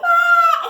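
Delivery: loud, high imitated child's wail; mock crying. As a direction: burst into a storybook wail. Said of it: "and he started to cry".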